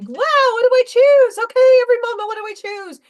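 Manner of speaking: giddy mocking squeal of excitement; mock over-the-top excitement. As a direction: ham up a breathless manifesting enthusiast. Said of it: "in my highest excitement all the time and going".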